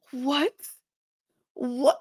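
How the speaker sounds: high-pitched incredulous fuming; mock fuming. Olivia reacting to the vote, mock fuming. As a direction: imitate her disbelief, voice pitched high. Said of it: "She's fuming".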